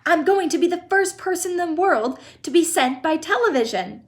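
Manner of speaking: put-on high, triumphant boyish call; excited boast. A narrator's reading. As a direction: crow it to the whole room. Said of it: "he shouted as he ran".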